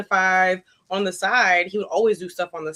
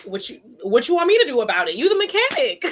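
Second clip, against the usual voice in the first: retold exasperation climbing much higher at the end; mock-indignant comeback, fast start rising high; exasperated retort; 7 semitones higher, 4 dB louder, faster than usual. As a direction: fire back incredulously, half laughing by the end.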